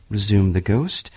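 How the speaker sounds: plain reading voice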